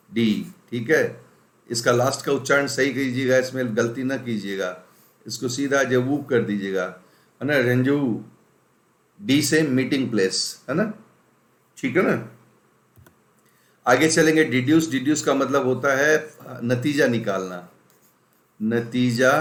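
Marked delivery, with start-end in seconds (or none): none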